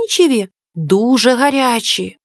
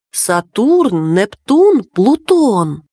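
ordinary delivery, nothing marked

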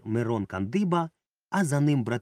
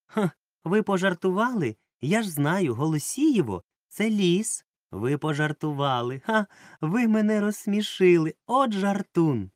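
forced, high, louder giggly flirting; fake laughter; 7 semitones higher, 4 dB louder than usual. A narrator's reading. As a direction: put on an affected laugh in the voice. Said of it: "Клава роблено зареготала".

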